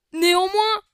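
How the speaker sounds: a boy playing Voldemort shouts at Harry Potter; hammed-up villain shout. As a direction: bellow the word like a cartoon villain. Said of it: "Donc quand c'est Voldemort, je vais faire cette voix".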